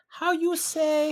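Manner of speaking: high, drawn-out mock Spanish accent; slow it right down in a high Spanish accent; fake Spanish accent. Mocking her doubling down, in fake Spanish accent. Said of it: "she's doubling down and saying".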